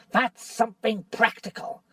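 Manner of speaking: lightly voiced bitter dwarf; sneering dwarf. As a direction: needle Caspian with rising scorn. Nickabrick argues for the witch.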